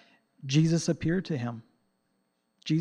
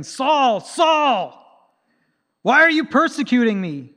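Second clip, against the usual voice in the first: much higher and louder, an angry accusation; 9 semitones higher, 8 dB louder, slower than usual. Shown as a demonstration. How Jesus did not speak to Saul: loud angry rebuke. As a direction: bark the name twice in fury. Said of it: "I don't think he said it angrily".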